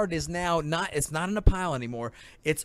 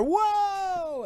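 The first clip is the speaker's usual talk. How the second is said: mock startled whoa. Movie actors jumping at explosion.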